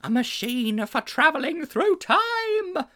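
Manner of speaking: high, slow, wild-eyed announcement; a teacher gripping the boys, crying out the reveal; excited cry. A narrator's reading. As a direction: proclaim it with wild intensity. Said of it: "he cried".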